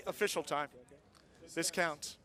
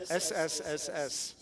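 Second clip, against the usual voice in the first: drawn-out s like a snake; 2 semitones lower, 3 dB quieter, slower than usual. Shown as a demonstration. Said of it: "You know what I mean?".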